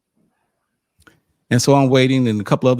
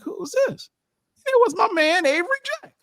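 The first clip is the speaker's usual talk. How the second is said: surprised. Retold or imitated. Surprised to see old friend.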